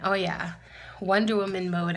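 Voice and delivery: plain reading voice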